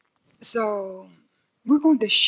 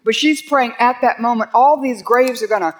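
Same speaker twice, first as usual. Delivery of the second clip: louder, higher preaching at full force; fervent preaching; 3 semitones higher, 5 dB louder than usual. Acted. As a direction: preach it with conviction. Preaching the rising dead.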